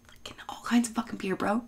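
unvoiced whisper of a rude answer; mock whispered retort. Whispering a rude answer to the young customer. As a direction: hiss it like a secret insult. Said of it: "And I was like".

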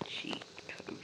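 breathy secret whisper. Pretend. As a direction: hush it like a secret. Secret cheat code whispered.

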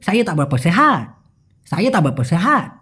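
words run together, last one pulled out; quick slang. Shown as a demonstration. Teacher showing fast speech, slurred together. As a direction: rattle through it, slurring the middle. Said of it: "যদি দ্রুত বলি".

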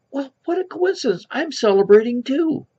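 high-pitched, lightly voiced lady; high storyteller's take on a pleased woman; joke's delighted lady. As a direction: lift it high, marvelling at the coincidence. Lady's excited reply in joke.

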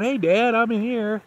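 high, loud voice for the dog; pretend dog voice. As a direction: give the dog a cheeky high voice. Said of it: "not going".

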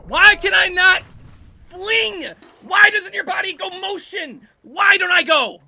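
high, loud wail of frustration; exasperated whining yell. Real, not acted. Player can't fling the climber, exasperated yell. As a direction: shout at an unresponsive game. Move fails in game.

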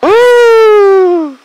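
loud, high whoop of delight; excited whoop. Real, not acted. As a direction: let out a big joyful woo. Cheering during airshow landing.

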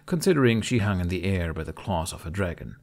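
plain reading voice

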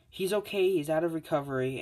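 high, bright nurse voice lifted well above her own; Recovery Girl. Retold or imitated. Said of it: "she's like".

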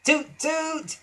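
high imitated horn toots; impatient toot. A narrator's reading. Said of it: "he heard an impatient".

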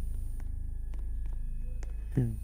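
very high, soft squealing laugh. Real, not acted. Laughing at friend.

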